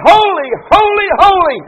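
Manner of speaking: holy, holy, holy pitched high and ringing out; soaring exalted proclamation; ringing cry. Retold or imitated. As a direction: lift each holy up like an angel's cry. Climactic quote of the seraphim.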